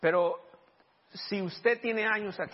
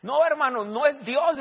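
higher and louder, firm contradiction; retold forceful rebuke; 9 semitones higher, 8 dB louder, slower than usual. Preacher retelling how he answered the brother, loud rebuke. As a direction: cut him off with conviction.

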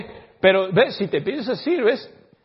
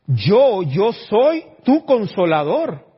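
slow, loud, solemn divine voice; booming slow proclamation; voice of God; 5 semitones higher, 10 dB louder, much slower than usual. Acted. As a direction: speak as God comforting, drawn out. God's voice in retold vision.

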